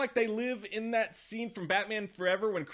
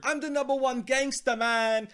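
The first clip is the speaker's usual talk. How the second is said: higher, louder gangster brag; mock gangster; 7 semitones higher, 7 dB louder than usual. Reviewer mocks the film's gangster boasting, higher and louder. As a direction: puff up like a cheesy movie thug. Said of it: "an even more annoying and stinky looking gangster".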